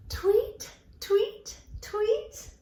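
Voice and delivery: high rising tweets; three spaced, high chirps; birdie sound. Pretend. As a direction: imitate a little bird chirping. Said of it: "we'll make the birdie sound".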